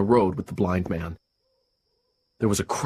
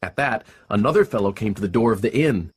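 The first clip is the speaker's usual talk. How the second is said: ordinary delivery, nothing marked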